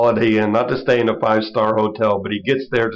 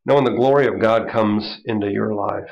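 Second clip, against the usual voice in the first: ordinary delivery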